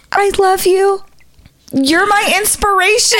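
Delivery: high-pitched gushing praise; very high, devoted little voice; imitated adoring fan. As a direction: mimic a starstruck fan's squeal.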